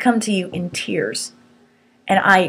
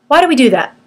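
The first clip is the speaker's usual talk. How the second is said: ordinary talk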